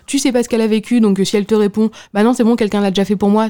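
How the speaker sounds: ordinary talk, nothing marked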